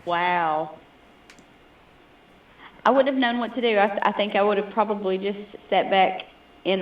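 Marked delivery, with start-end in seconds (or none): none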